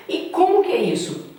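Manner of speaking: ordinary talk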